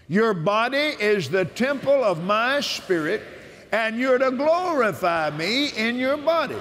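solemn preacher's proclamation; preaching scripture. Acted.

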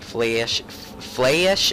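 "Flesh" stretched into two syllables, flay-esh; mimicked pronunciation. Gamer checks friend's way of saying it, mimicking "flesh" as flay-esh. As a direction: echo "flesh" as a drawn-out flay-esh, questioning. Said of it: "How'd you say?".

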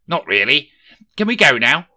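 loud, high whiny impatience; impatient. A narrator's reading. Impatient Rishi begs the ghost to leave, loud and whiny. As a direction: whine to be let off. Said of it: "asked an impatient Rishi".

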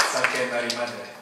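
ordinary talk

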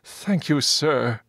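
sudden high blurt after a smack. Acted. Young warrior smacked on the head blurts his thanks, high. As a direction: blurt it out in a yelp. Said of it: "blurt out".

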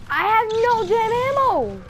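high, slow exasperated yell; frustrated yell. Real, not acted. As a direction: drag it out in frustration. Out of ammo, cornered.

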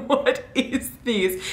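very slow, high stunned question; mock disbelief. Heroine reacting to their proposition, slow disbelief. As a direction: drag out each word, imitating someone dumbfounded.

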